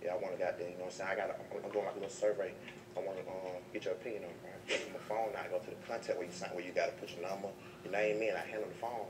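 low, soft salesman voice; a quiet, polite pitch to a girl; lightly voiced soft-spoken surveyor; smooth survey pitch. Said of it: "I call it the survey".

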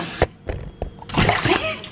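high startled gasp; sharp gasp. Real, not acted. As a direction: gasp in surprise. Sees chicken rice request.

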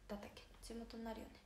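soft breathy whisper to herself; whispered aside. Real, not acted. 視聴者の地元の話をしながら独り言のように囁く. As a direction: drop to a whisper while musing aloud. Aside while musing about hometown.

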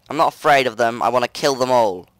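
usual speaking voice

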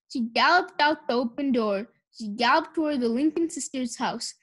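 plain reading voice

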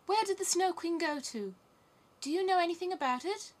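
put-on high, loud little girl; high-pitched, anxious questioning; little Gerda, urgent. A narrator's reading. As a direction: press for news in a child's high voice.

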